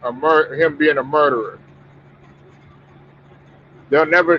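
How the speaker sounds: ordinary talk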